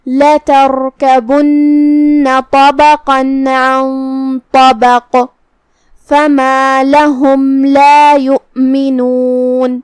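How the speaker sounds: slow chanted tajweed recitation; melodic, measured verse chant; Quran recitation. Acted. Teacher reciting verses for students, slow chant.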